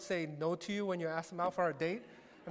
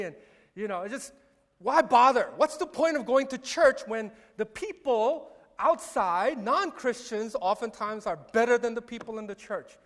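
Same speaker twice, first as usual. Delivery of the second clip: husband ranting louder at his wife about church hypocrites; begrudging rant; 2 semitones higher, 5 dB louder than usual. Retold or imitated. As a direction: vent like a grumpy husband dragged out of bed. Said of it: "get up very begrudgingly and is like".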